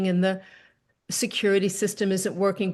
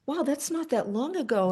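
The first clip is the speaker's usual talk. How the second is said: higher, softer consoling remark; gentle sympathy; 6 semitones higher, 3 dB quieter than usual. Retold or imitated. To the widowed repairman, a gentle softer reply. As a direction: say it softly, feeling for him.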